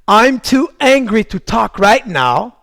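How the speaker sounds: firm, drawn-out demonstration phrase; slow, deliberate declaration. Shown as a demonstration. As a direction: spell it out slowly and forcefully. English anger phrase, full-voiced take. (phrase lente, ferme et appuyée)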